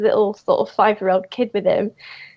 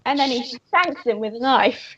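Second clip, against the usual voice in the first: normal talking voice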